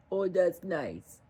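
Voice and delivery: ordinary delivery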